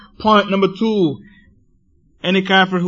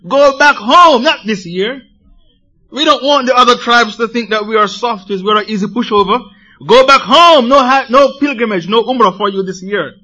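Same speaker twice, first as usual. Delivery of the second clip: loud, raised voice turning them away; mock angry idol worshippers; 5 semitones higher, 6 dB louder than usual. Preacher voicing Quraysh turning the Muslims back, loud and hostile. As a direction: bark it like a hostile gatekeeper. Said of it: "telling them".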